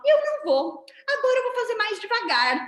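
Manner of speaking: high, loud mock-petulant retort; acted stubborn refusal, pitched very high; defiant refusal. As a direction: dig your heels in like a sulky child. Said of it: "a gente olha pra professora e fala".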